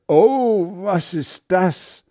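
high, loud startled gasp, then slow; startled cry. Acted. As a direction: wake up with a jolt and cry out. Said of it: "Er ruft".

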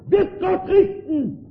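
hoch und laut aufgeschrien, langsam; aufschreiend. Retold or imitated. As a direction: die Welt schreit entsetzt auf. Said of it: "schrie sie auf". (laut und langsam aufschreiend)

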